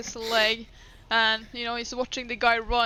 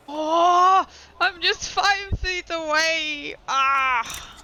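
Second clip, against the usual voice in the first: loud, higher groan of dismay; real frustration; 6 semitones higher, 5 dB louder, much slower than usual. Real, not acted. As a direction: groan it out, drawn out, at falling just short. Finds move falls just short.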